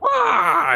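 very high shrieking yelp of panic; frightened scream. Acted. Old man fleeing behind the walls, high panicked shriek. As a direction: shriek and bolt away.

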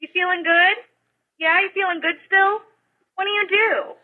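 high, sugary mock voice of a nosy stranger; nosy stranger at a grocery checkout, mock sweet. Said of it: "This woman was literally like".

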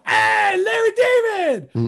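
high, loud, drawn-out shout; screaming. Retold or imitated. People in the station spotting Larry David, screaming at him. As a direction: holler like an excited passer-by. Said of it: "screaming at him".

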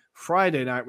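normal talking voice

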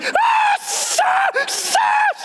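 very high shrieked stop; screaming stop. Retold or imitated. Terrified skydiver shrieking in freefall. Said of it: "And I'm screaming".

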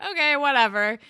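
loud, dismissive "Okay, whatever". Retold or imitated. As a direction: shrug it off loudly and with disdain. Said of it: "And you're like".

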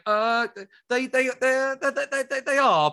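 high, halting stutter; flustered stammer. Retold or imitated. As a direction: stammer on one word, acting tongue-tied. Flustered answer to idol's question.

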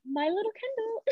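softly doting my little Kendall; fond cooing. Real, not acted. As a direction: coo it like a proud aunt. Fond of a show character.

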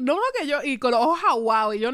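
ordinary talk

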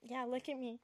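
soft coy self-praise. Acted. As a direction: murmur it coyly, showing off.

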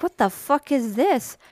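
high, slow disgusted question; disgusted surprise. Retold or imitated. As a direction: recoil at strange food. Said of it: "And I'm like".

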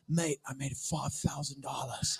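slow, low, soft chuckling Aussie voice; a light Australian drawl, laughing; lightly voiced Australian bragging; Australian mate. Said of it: "He's like".

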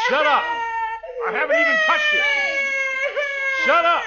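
loud, high furious shout. Acted. Uncle yelling at the bratty boy, furious shout. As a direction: bellow it to drown the kid out. Yelling at screaming spoiled child.

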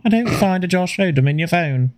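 high-pitched mock assistant voice; put-on virtual assistant reply; Siri. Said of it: "Hey Siri".

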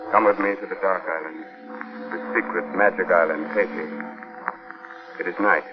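low, slow scene-setting narration; hushed storyteller. Acted. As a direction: draw the listener into the dark. Host narrates the opening scene.

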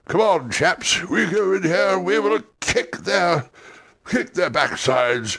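put-on high, rousing old officer voice; rally the chaps like an old captain; old campaigner pep talk. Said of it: "with pep talks".

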